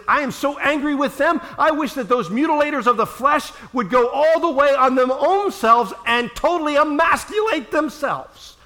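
high, loud, slowing into a shout; Paul's fury. Acted. Paul railing at the Judaizers, furious shout. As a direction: spit out the curse on the Judaizers. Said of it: "he gets so angry".

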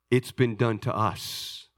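normal talking voice

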